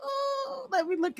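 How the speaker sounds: wheezing out words through laughter; real helpless laughter; breathless crying laugh. Real, not acted.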